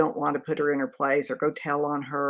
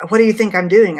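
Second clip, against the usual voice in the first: loud, higher shouted snap; angry shout; 6 semitones higher, 12 dB louder than usual. Retold or imitated. The rude nurse snapping at the daughter, shouted. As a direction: bark it back like the rude nurse.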